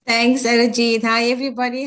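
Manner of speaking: usual speaking voice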